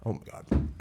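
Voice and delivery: choking cough after hot sauce; coughing from heat. Real, not acted. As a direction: gasp then cough. Just ate super hot sauce.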